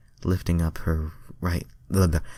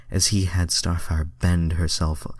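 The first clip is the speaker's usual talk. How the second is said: usual speaking voice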